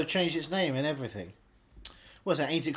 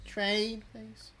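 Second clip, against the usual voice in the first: slow; 5 semitones higher, 5 dB quieter, much slower than usual. Pretend. Slow drawn-out mock trailer line.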